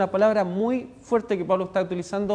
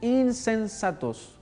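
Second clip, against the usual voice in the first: insensatos said even slower, pitched up and falling; slow stern repeat; 4 semitones higher, much slower than usual. Acted. Preacher repeating the rebuke to the church, slow and stern. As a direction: drag insensatos out once more, sternly.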